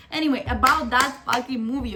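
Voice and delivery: ordinary talk, nothing marked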